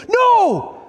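a sudden high yell of protest; horrified no. Acted. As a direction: cry out in mock horror. Hearers protest at God's judgement.

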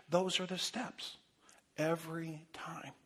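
slow, whispered emphasis; intense whisper. Acted. Preacher urging the congregation, slow whisper. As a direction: drop to a whisper to drive it home. Preacher stresses steps of repentance.

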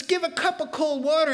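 exclaiming the words of Jesus; emphatic quote. Acted. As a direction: ring out the promise.

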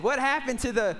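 normal talking voice

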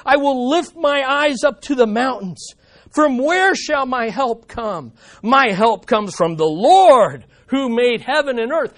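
preacher reading a psalm to the church, fervent; scripture reading. Acted. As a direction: read the psalm aloud as a heartfelt cry. Reads psalm aloud.

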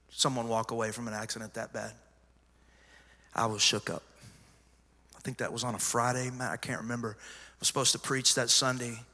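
ordinary talk

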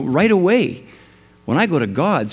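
usual speaking voice

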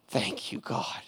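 patient quietly thanking God for his rescuer; hushed gratitude. Retold or imitated. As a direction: murmur it in quiet awe. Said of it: "And he's like".

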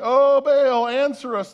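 loud, high, drawn-out cry to a god; pleading shout. Acted. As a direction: call out like priests begging for an answer. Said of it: "they shouted".

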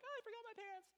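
squeaky high panicked gasp of a line; mock dismay. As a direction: act like a kid who just noticed no pants. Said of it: "you're like".

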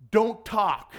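emphatic acted command; firm command. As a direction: voice a firm divine command to stop.

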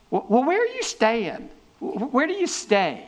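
high, stammering, caught-off-guard voice; preacher mimicking nervous disciples asking Jesus; flustered disciples. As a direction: stumble over it like startled followers.

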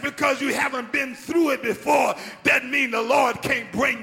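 loud, high shouting preacher building up; preaching at full force. Real, not acted. Pastor shouting to the congregation, full force preaching. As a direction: preach it out to the whole church.

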